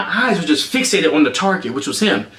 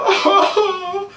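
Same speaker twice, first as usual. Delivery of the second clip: high, drawn-out fake wail; hammed-up sobbing "oh"; mock crying; 13 semitones higher than usual. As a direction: imitate mom bawling dramatically. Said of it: "I think she started crying".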